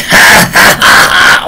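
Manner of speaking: mock belly laugh, high and loud; imitated burst of disbelieving laughter; Chuck Sullivan. Imitating the interviewee laughing at a claim, mock guffaw. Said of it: "Chuck Sullivan actually starts laughing".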